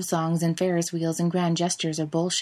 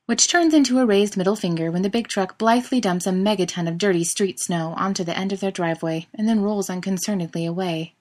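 usual speaking voice

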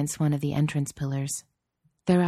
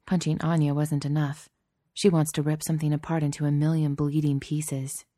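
plain reading voice

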